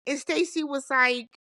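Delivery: usual speaking voice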